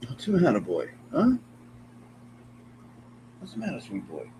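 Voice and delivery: soft, cooing voice to the cat. Real, not acted. Streamer coos to his cat, softly.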